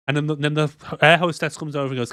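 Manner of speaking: normal talking voice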